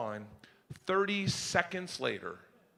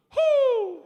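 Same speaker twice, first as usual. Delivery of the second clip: high, loud owl hoot; 8 dB louder than usual. Retold or imitated. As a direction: hoot out over the lake like an owl. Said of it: "My dad just gets on the edge of the dock and goes".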